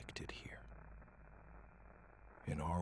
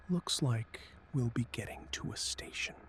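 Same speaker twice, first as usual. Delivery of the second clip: mildly thoughtful, higher-pitched musing; 7 semitones higher, 4 dB louder than usual. A narrator's reading. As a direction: muse aloud with a touch of thought. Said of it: "Uncle Zora said thoughtfully".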